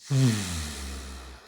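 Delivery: long, falling exhale; long sigh. Real, not acted. Meditation teacher settles into the breath, long sigh.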